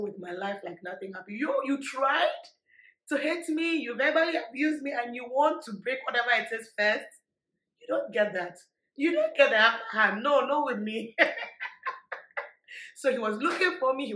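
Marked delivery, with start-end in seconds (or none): 1.4-10.2 loud, firm telling-off of the ex; defiant retort. Retold or imitated. Retelling how she told off her ex, loud and firm. 10.2-11.1 loud, laughing no; laughing refusal. Retold or imitated. Laughing as she refuses her ex. 11.2-12.9 airy laugh, pitched high; high breathy laugh. Real, not acted. Laughing at her own comeback to the ex.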